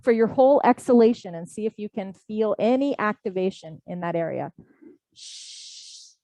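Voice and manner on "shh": long soft unvoiced shh on the exhale; sh breath sound. Shown as a demonstration. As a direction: let the breath out as a steady shh. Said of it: "with the sh sound".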